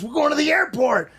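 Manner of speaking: high, matter-of-fact driver voice; driver's reply. Retold or imitated. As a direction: answer like an unbothered driver. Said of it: "He turns back and goes".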